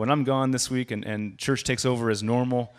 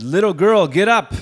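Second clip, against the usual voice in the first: loud, higher commanding call; Jesus shouting; 9 semitones higher, 11 dB louder than usual. Retold or imitated. Jesus calling the dead girl to get up, loud command. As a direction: command her to rise, full voice. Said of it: "One time when he shouted".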